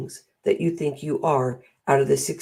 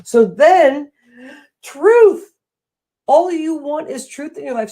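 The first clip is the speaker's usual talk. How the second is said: much higher, louder proclaiming; emphatic truth; 10 semitones higher, 8 dB louder than usual. Real, not acted. Coach stressing truth to listeners, emphatic. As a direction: declare the word truth.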